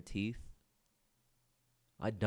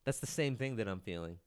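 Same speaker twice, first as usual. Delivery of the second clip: ordinary talk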